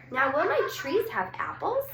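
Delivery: put-on eager question from an impatient child; hopeful girl. A narrator's reading. Sophie asks hopefully.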